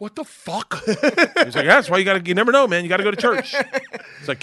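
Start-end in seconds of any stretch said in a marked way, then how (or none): 0.0-0.8 high, hushed what-the-fuck; mock shock at seeing him in church; stunned disbelief. Hearing Doug Williams went to church, stunned. 1.5-3.5 high, loud eager preaching; a buddy hyping up church; excited friend. Retold or imitated. Friend urging him to go to church, excited and loud.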